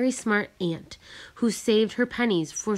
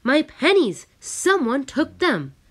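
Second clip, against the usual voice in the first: high, loud cry of alarm, slowing at the end; shouting; 8 semitones higher, 6 dB louder, slower than usual. A narrator's reading. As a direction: shout it out as the pennies are gone. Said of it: "I rushed out of the room shouting".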